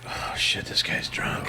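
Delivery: hushed, voiceless whisper; whispered aside. Retold or imitated. Passenger realizing the guy is drunk, whispered aside. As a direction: whisper it as a private thought. Private reaction on the plane.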